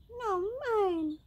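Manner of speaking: high, drawn-out whine; high, complaining little voice; whining flea. A narrator's reading. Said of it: "whined the flea".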